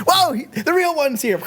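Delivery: cartoon idols gasping as the real God walks in; startled idols. Egyptian idols seeing Jesus arrive, mock alarm. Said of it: "They're just like".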